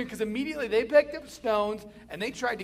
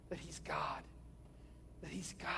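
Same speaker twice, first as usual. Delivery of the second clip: whispered; 4 semitones lower, 15 dB quieter than usual. Real, not acted. Preacher hushing the key point for listeners, whispered. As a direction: drop to a hushed whisper for the point. Driving home sermon point.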